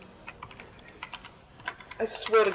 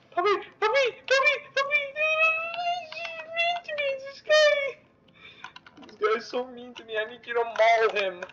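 put-on much higher, childish tattletale whine; whiny kid voice. Gamer whining to his puppy about a mean enemy, in a childish voice. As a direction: whine like a little kid telling on a bully.

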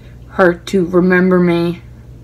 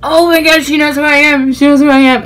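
excited; 8 semitones higher, 5 dB louder than usual. Retold or imitated. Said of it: "And I was like".

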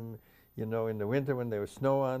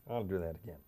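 ordinary talk

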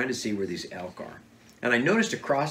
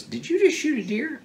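much higher-pitched, incredulous question; retold astonishment. Guide questioning hunter about the shot, mock astonishment. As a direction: imitate a guide who can't believe it.

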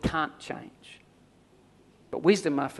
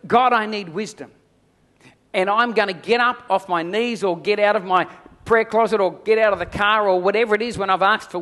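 louder, higher declaration of trust; fervent preaching; 5 semitones higher, 5 dB louder than usual. Acted. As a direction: proclaim it like a rallying cry to the room. Preacher voices a bold prayer.

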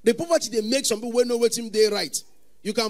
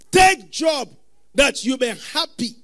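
louder, higher, slow warning; stern preaching; 4 semitones higher, 7 dB louder, slower than usual. Acted. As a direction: press the warning home. Preaching shout.

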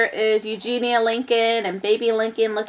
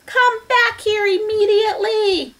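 high-pitched commanding call; shouted order; 11 semitones higher, 3 dB louder than usual. A narrator's reading. Eugenia ordering Baby back, high shout. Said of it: "shouted Eugenia".

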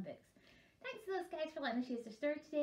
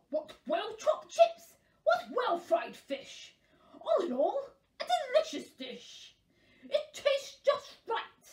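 slow, savouring praise rising high; delighted king; 9 semitones higher, 4 dB louder, slower than usual. Acted. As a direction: relish each phrase as a very pleased king.

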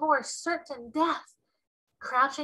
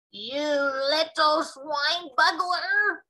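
high, loud, slow giant roar; roaring insult; 6 semitones higher, 5 dB louder, much slower than usual. Acted. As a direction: bellow the insult. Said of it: "roared the Bloodbottler".

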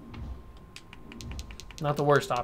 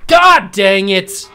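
loud, slow drawn-out angry yell; furious shout; 3 semitones higher, 9 dB louder, much slower than usual. Real, not acted. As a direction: curse the lost pick at full volume. Missed draft pick, outburst.

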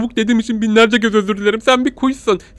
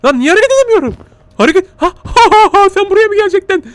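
panicked; 13 semitones higher, 6 dB louder than usual. Real, not acted. Can't move, panicking.

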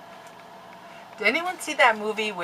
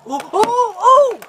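sudden very high loud yelp; startled shriek; 12 dB louder, slower than usual. Real, not acted. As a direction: shriek out in shock.

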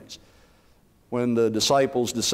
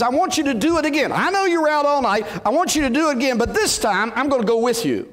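urgent Jesus voice; 11 semitones higher, 4 dB louder than usual. Retold or imitated. Jesus voiced urging disciples.

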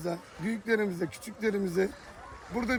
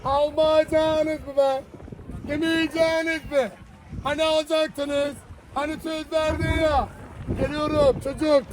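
street vendor call; 4 semitones higher than usual. Acted. Simit seller calling to passersby, street hawking patter.

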